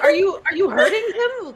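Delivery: startled cleric. Acted. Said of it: "and be like".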